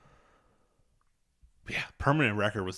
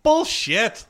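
high, slow drawn-out bullshit; scoffing; 10 semitones higher than usual. Real, not acted. Friend scoffing at teachers' college warnings, slow and high. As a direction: drag it out in disbelief. Scoffing at what they were told.

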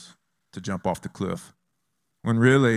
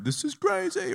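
very high falsetto squeal; comic high-pitched alarm; mock rider's fright. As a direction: squeal like a first-time coaster rider. First-time roller coaster rider.

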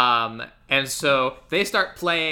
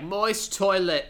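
"Moist toilet" slow and higher in fake British; mock Cockney; 5 semitones higher, slower than usual. Host imitating the club owner announcing bands, mock Cockney "Moist toilet". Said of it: "but he says it like".

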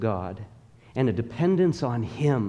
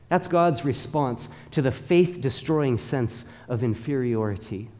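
ordinary delivery

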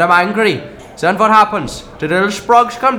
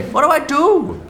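desperate; 9 semitones higher than usual. Acted. Desperate question.

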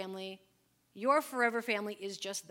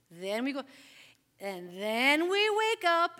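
put-on slow, sing-song child reciting each step; kids reciting routine; 3 semitones higher, much slower than usual. Mom imitating her kids chanting their schedule, sing-song and slow. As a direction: spell out the bedtime routine like a small child. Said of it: "they would say".

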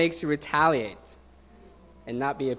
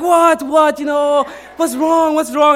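put-on alarmed kids; 11 semitones higher, 12 dB louder, slower than usual. Students alarmed at the news.

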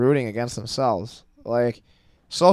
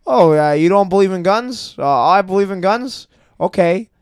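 sneering at both sides with raised voice; mocking, higher, louder; 5 semitones higher, 5 dB louder than usual.

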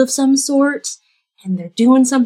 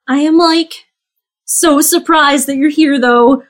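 lightly voiced friend, pitched higher; a higher voice for a surprised reunion; lifted pitch, mildly surprised to see a friend; reunited friend; 6 semitones higher than usual. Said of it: "and she's like".

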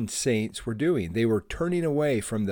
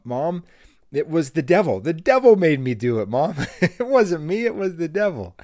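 put-on higher, louder whiny child excuse; pleading kid; 6 semitones higher, 5 dB louder than usual. Boy pleading with mom after stealing candy, whiny higher kid voice. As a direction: plead your innocence like a caught kid. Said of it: "And I told her".

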